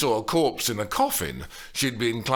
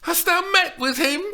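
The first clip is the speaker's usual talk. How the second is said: put-on high, loud eager girl's voice; screeched excitedly; 7 dB louder than usual. A narrator's reading. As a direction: plead eagerly as young Juliet. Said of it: "Steph screeched excitedly".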